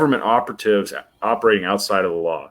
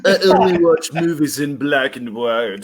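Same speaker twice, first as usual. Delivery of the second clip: higher-pitched put-on snob voice; mock film snob; 5 semitones higher than usual. Teasing a friend about classic movies, mock snobbish. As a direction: lord it over them as a joke. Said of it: "lord it over everybody else".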